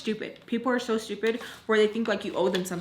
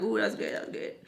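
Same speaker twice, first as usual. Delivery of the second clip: retold quiet approval; 6 dB quieter, faster than usual. Private remark while smiling, retold.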